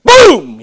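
high, loud shouted boom; sudden shouted gun blast; shotgun boom. Retold or imitated. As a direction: blast it out like a gunshot. Gunshot in the hunting story.

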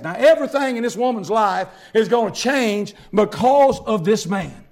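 normal talking voice

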